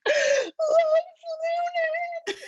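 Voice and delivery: high, drawn-out sobbing cry; long high tearful cry. Real, not acted. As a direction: wail the name through tears, overwhelmed. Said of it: "I'm just bawling my eyes out".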